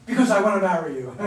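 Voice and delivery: loud, laughing line of a hammed-up proposal; laughing mock proposal. Critic acting out a better ending for Thomas, loud and laughing. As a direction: burst into the line while laughing at it. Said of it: "Like you could say".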